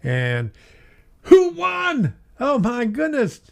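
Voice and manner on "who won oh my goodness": excited. Real, not acted. Livestream host checks giveaway winner.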